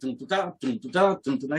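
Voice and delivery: steady "dum dum da" groove; vocalised drum beat. Retold or imitated. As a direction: mouth a basic rock beat. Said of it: "I do the normal beat".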